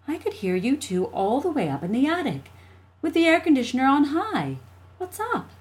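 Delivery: high, swooping mom voice; exasperated mother. Acted. Mother to the two fighting children, exasperated. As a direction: complain at the noisy kids. Mother character scolds from stairs.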